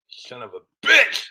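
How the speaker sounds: high, slow Yankee curse; old New England accent. Pretend. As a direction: swear like a cranky old Yankee. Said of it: "doing my Yankee voice here".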